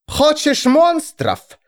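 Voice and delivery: very high, drawn-out threat; savage challenge. A narrator's reading. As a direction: fling the dare out slowly. Enraged Vigga challenges the bishop.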